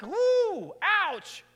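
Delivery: high, loud "ooh, ouch" as if it hurts; sudden high pained ouch; retold yelp of pain. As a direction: squeal at the sore spot. Pain shot up his calf.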